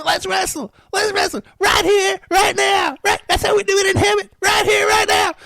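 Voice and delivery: put-on high, loud, drunken shouting demand; mock drunk woman yelling; drunk girlfriend. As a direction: shout it like a tipsy girlfriend itching to wrestle.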